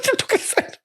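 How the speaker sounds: breathy high whisper through laughter; imitating the farmer through a laugh. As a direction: whisper it, barely holding in a laugh.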